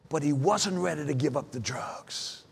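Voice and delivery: low, soft admission of loss; grave and subdued; somber, hushed. Real, not acted. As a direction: drop right down, low and quiet. Recounting brother's fatal overdose.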